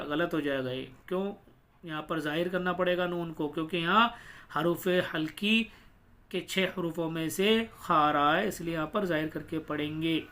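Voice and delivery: ordinary talk, nothing marked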